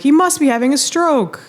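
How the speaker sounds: high mock alarmed bystander; a hint of a worried onlooker. Bystander on the ground diagnoses the pilot, mock alarmed. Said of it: "someone's like".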